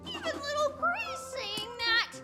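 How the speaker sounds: high-pitched cry of betrayal; wounded, high protest; dismayed whine. Acted. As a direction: complain in a high voice that nobody backs you. Dismayed that friend sides against.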